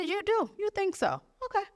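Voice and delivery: high, sing-song mock innocence; sarcastic high-pitched "you do?"; mock innocent. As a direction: act sweetly unbothered before a fight. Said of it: "I said".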